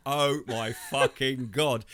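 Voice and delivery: slow, drawn-out gasp of disbelief; retold shock. Rounding the corner onto the scene, a slow shocked oath. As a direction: stretch out the disbelief. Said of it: "And then we've just got around the corner and gone".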